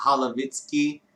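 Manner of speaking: normal talking voice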